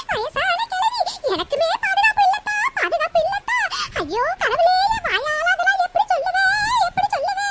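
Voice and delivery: high, sing-song sobbing lament; hammed-up old woman in distress; tearful wailing. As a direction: cry it out with long wailed endings. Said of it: "எதுக்கு பெரியம்மா அழுதுட்டு இருக்கீங்க".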